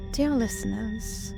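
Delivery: ordinary delivery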